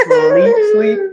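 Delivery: put-on squeaky little kid voice; high-pitched toddler imitation; high baby voice. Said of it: "she was like".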